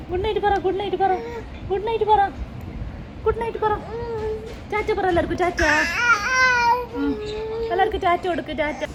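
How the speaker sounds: high sing-song coaxing; lilting high prompt to say bye. Real, not acted. Prompting baby say goodnight.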